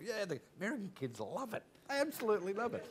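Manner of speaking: high-pitched voiced youngster's justification; retold enthusiastic reply. Boy explaining to his parents, enthusiastic reply.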